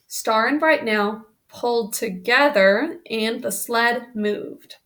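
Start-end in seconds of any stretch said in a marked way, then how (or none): none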